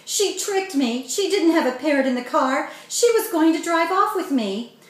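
high, loud shaken outburst; frightened rush. A narrator's reading. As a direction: pour it out, still scared. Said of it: "Words were tumbling from Kevin".

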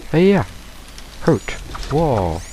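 normal talking voice

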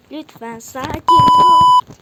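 very loud, high, held-out scream; frustrated outburst. Real, not acted. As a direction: plead for calm, exploding into a screech. Frustrated plea to stay calm. (sinirden tiz çığlık)

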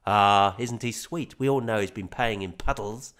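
ordinary delivery

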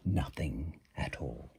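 put-on quiet punchline to children; hushed answer. A narrator's reading. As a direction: drop it quietly to the listeners. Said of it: "can you guess what he said".

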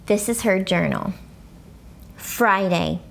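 plain reading voice

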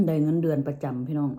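ordinary delivery

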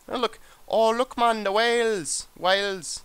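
high, slow attempt at Welsh; badly faked Welsh accent, pitched well up. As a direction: stretch it out in a makeshift Welsh lilt. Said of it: "I don't know a Welsh accent".

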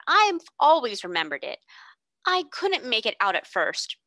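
a hint of the warm baker woman remembering; bun woman. A narrator's reading. Bun woman voiced in story.

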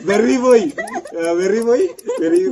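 loud, high, laughing through words; laughing while talking. Real, not acted. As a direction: blurt answers through laughter.

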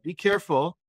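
ordinary delivery

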